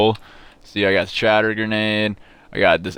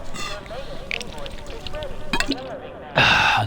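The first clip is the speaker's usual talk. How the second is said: long breathy exhale; long sigh; 5 dB quieter than usual. Real, not acted.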